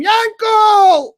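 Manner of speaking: high, loud cry of a name; shocked scream. Retold or imitated. Spotting Yankel at the grave, screaming his name. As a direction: call out his name in disbelief. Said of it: "I start to scream".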